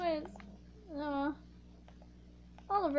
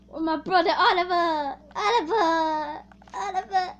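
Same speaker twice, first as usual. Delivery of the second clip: mock wail; 4 semitones higher, 8 dB louder than usual. Crying out for fallen brother.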